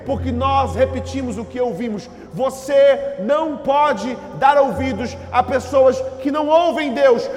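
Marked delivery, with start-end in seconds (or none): 2.3-7.3 loud, slow, climbing preacher's warning; full-force preaching. Acted. Pastor warning congregation, loud building preaching. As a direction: hammer it home to the flock.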